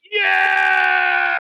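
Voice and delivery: high, loud shouted cheer; whooping, full-out yell; wild crowd cheer. Acted. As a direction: yell like a crowd going crazy. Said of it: "Everybody's, like, crazy".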